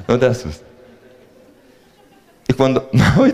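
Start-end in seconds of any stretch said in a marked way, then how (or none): none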